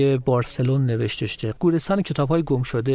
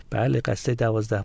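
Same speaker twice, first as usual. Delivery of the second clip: normal talking voice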